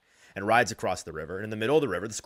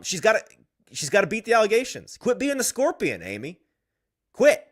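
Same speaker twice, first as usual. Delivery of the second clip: building rant; 10 semitones higher, 7 dB louder than usual. Real, not acted. Host's rising rant at owner.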